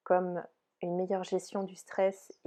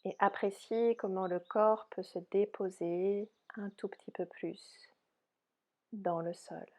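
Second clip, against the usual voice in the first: soft, unhurried yoga nidra guidance; softly spoken relaxation voice, spacious phrasing; guided meditation; 4 dB quieter than usual. Real, not acted. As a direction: guide them gently into rest, with long pauses.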